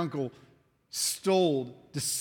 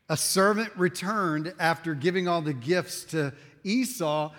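ordinary talk, nothing marked

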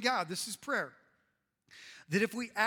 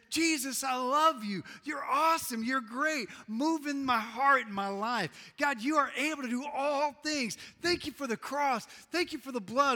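raised, fervent declaration to God; prayer of praise; 4 semitones higher, 3 dB louder than usual. Acted. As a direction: praise out loud, pitched up and emphatic. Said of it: "that aspect of prayer that says".

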